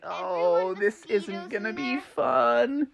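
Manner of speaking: soft, high, slow worry; quiet dread. A narrator's reading. Froggy approaching Mosquitosville, soft high dread. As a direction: say it small and apprehensive.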